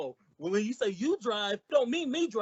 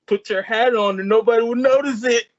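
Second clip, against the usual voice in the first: much louder, brash; loud wisecrack; 11 dB louder than usual. Acted. Costello loudly mocking Missus Niles. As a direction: blurt the insult out.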